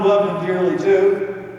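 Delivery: normal talking voice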